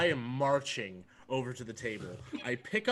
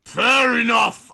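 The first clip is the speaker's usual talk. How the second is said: loud, slow, tipsy slur; slightly slurred; 7 semitones higher, 12 dB louder, slower than usual. Acted. Dredger after drinking, answering the barkeep, slightly slurred shout. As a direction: blurt it high and drawn out, a bit drunk. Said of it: "I'm, like, slurring a little bit".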